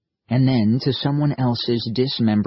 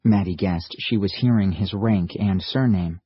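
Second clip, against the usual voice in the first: ordinary delivery, nothing marked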